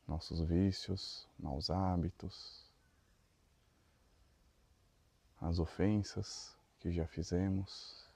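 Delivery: slow, soft meditation voice with long pauses; guided meditation. Real, not acted. Leader guiding the group through breathing and neck stretches, guided meditation. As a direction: lead them gently through each breath, unhurried.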